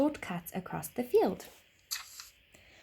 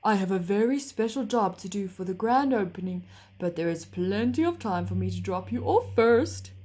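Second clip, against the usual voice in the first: horse voice; 4 dB louder than usual. A narrator's reading. Horse character voice.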